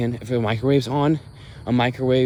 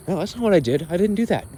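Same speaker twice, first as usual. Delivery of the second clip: higher-pitched imitation of a defensive denial; mock protesting denial; 6 semitones higher than usual. Imitating dad denying what he did, mock protest. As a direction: deny it in a mock-indignant, raised pitch.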